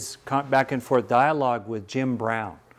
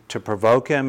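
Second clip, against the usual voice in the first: ordinary talk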